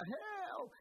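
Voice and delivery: one long, high imitated call; retold cry for help. Pastor imitates the cry that kept coming from the neighbour's house, high calling. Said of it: "una voz que gritaba".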